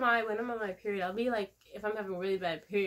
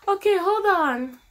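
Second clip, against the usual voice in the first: high, louder, slow startled reaction; 10 semitones higher, 10 dB louder, slower than usual. Retold or imitated. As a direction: act suddenly taken aback. Retold nurses startled.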